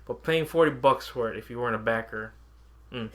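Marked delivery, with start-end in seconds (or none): none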